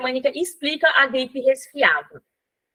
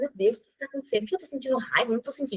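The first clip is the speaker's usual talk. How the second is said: soft, puzzled self-talk under the breath; mother quietly asking herself why she feels anger, hushed; muttering to herself; 9 dB quieter, much faster than usual. Retold or imitated. Retelling her own inner doubts.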